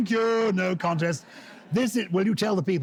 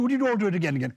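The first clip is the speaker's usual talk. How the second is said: ordinary talk, nothing marked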